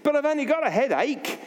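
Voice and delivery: man pleads he only has a headache; protesting. Acted. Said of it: "The man protested".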